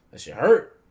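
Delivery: loud, high hurt exclamation; mock pained shock. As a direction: yelp it as if just hit. Acts the Admiral hit by the blast.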